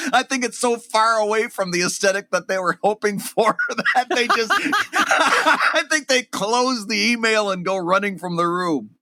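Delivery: can't stop giggling mid-sentence; laughing. Real, not acted. Host cracking up telling his cohost the story, laughing.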